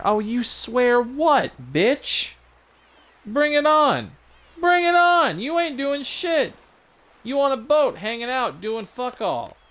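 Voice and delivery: very high-pitched, loud goading; mock fighting taunt. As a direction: dare them to fight in a squeaky-high shout. Player taunts a threatening character.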